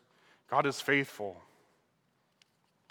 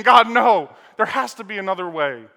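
pleading; 5 semitones higher, 13 dB louder than usual. Retold or imitated. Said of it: "crying, pleading".

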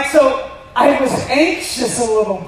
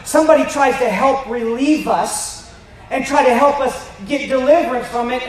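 usual speaking voice

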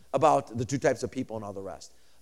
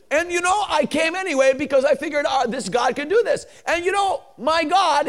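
high, loud, exultant retelling; exultant testimony; 10 semitones higher, 6 dB louder than usual. Retold or imitated. Preacher relays the mute man's testimony to the congregation, exultant. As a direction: voice a triumphant testimony at full volume.

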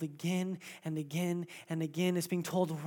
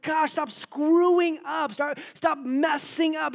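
exasperated scolding; 10 semitones higher, 8 dB louder, slower than usual. Pretend. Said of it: "he's not looking at us like".

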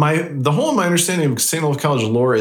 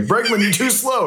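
higher-pitched mock yell, no real volume; raised-pitch retelling of a fan's jeer; retold heckle; 9 semitones higher than usual. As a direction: imitate a ballpark heckler without shouting. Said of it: "a guy yelled to Alex Bregman".

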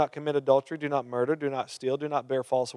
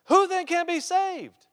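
high, slow, incredulous cry; astonished disciples; 13 semitones higher, slower than usual. Retold or imitated. Disciples asking Jesus in disbelief, high astonished voice. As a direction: gasp it out like a stunned disciple. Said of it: "Poor people ain't gonna be reacting like that".

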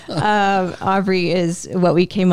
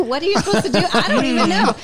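high, giggling through the words; laughing; 11 semitones higher, faster than usual. Real, not acted. Mom laughing at not knowing labor breathing. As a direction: laugh at her own cluelessness. Laughing at her own cluelessness.

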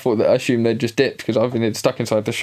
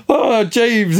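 higher-pitched mock Irish dad; imitated Irish voice, pitched up; [name]'s Irish voice; 9 semitones higher than usual. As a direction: put on an Irish dad's telling-off. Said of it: "I just hear [name]'s Irish voice".

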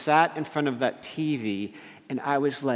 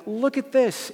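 high, eager show-and-tell voice; put-on girlish pitch; imitated teenage daughter; 10 semitones higher than usual. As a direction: mimic a girl proudly showing a find. Daughters showing off their spiders.

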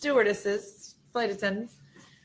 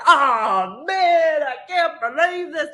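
loud, high mock lament; imitating a dramatic traveller moaning about the missed flight, hammed up; over the top drama; 7 semitones higher, 5 dB louder, slower than usual. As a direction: ham up his despair. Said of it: "And all the drama".

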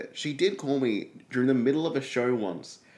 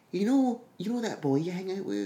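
high-pitched mock mum voice; imitated mum; 10 semitones higher than usual. Mum to child years later, imitated gentle lead-in. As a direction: imitate a mother broaching news, pitched up.

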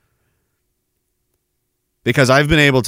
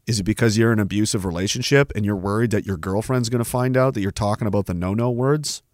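ordinary talk

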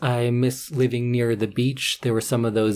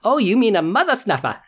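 bright, high mock Australian chirp; a playful Aussie making it cute; mock Australian accent; 8 semitones higher than usual. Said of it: "And they go".

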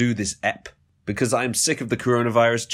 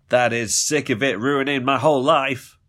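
ordinary talk, nothing marked

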